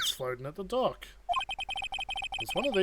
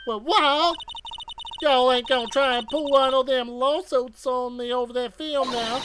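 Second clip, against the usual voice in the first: put-on Southern accent; 8 semitones higher, 6 dB louder than usual. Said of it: "my best Southern Belle voice".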